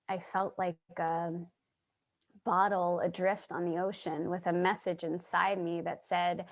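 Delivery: ordinary delivery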